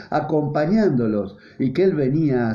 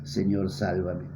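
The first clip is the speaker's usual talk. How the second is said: much softer, slow hushed plea; quiet plea; 8 semitones lower, 8 dB quieter than usual. Acted. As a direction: breathe it as a humble prayer. Believers' plea to the Lord.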